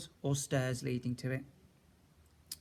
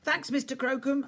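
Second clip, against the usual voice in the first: put-on young Frankie; 11 semitones higher, 4 dB louder than usual. A narrator's reading. Frankie thanks gardener.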